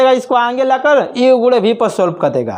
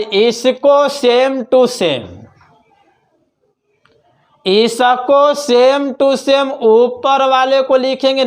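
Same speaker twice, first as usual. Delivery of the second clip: drawn-out, word by word; slow dictation; much slower than usual. Shown as a demonstration. Teacher dictating to students, very slow. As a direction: spell it out slowly for the class.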